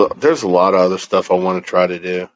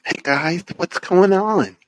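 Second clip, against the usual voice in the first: cheery greeting voice; 11 semitones higher, slower than usual. Pretend. Acting out the message he will send.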